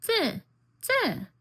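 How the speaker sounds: put-on high, falling one-syllable toddler babble; baby talk. Mom imitating her one-year-old pointing at things, high baby talk. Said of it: "she'll point and be like".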